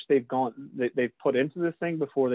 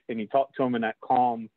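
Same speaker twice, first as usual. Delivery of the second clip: usual speaking voice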